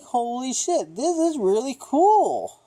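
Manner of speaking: high-pitched, drawn-out awe; gushing retold excitement; amazed reaction. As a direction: exclaim it high, stretching the words.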